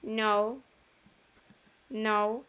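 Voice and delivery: "no" with a rounded "oh-oo" glide. Shown as a demonstration. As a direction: model "no" as English "noh-oo". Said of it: "se lee como O". ("no" pronunciando la O como "ou")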